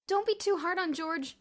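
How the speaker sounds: high, loud shout over a crowd; shouting over noise. A narrator's reading. Bruno calls to the crowd about George, shouting. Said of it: "shouted Bruno over the general din".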